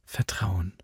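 soft, low-key affirmation; hushed, steady echo; quiet confirmation. A narrator's reading. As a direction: confirm it calmly and softly. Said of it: "bestätigte Alva".

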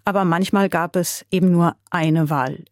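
ordinary delivery, nothing marked

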